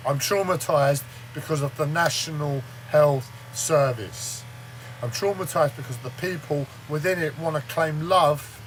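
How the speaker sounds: ordinary talk, nothing marked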